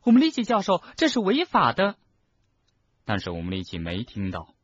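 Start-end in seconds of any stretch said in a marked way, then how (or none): 0.0-2.0 put-on high, loud girl's outcry; shrieked protest. Schoolgirl warning professor it is illegal, shrieking. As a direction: protest urgently at the top of the voice. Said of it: "赫敏尖叫道".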